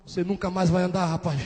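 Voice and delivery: low, hushed doctor's verdict; veredito grave em voz baixa. Retold or imitated. Médico dá a má notícia. (notícia ruim, baixinho e grave)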